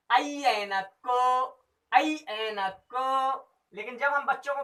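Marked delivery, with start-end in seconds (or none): 0.1-3.4 put-on ऐ ऐनक को spelled slowly like to children; slow teaching. Shown as a demonstration. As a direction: drill it slowly for kids. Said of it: "ये ऐसा जाना भी जाता है". (बच्चों को पढ़ाने जैसा धीमा उच्चारण)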